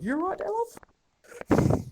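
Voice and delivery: very high, slow mocking falsetto; guard's mocking reply. GM voices guard pushing player.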